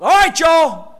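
lightly voiced folksy Nehemiah, easygoing; a Nehemiah who talks like a laid-back Southern preacher; preacher playfully imagining Nehemiah telling the crowd to move on; casual Nehemiah. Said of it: "If I was Nehemiah, the way I talk".

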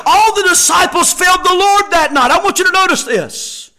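high, loud, driving sermon delivery; impassioned pulpit voice; full-force preaching. Real, not acted. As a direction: preach it hard to the congregation, punching key words.

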